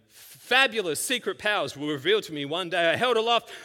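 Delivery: hammed-up heroic narration; He-Man voice. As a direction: ham up the cartoon hero. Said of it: "I'm quoting him".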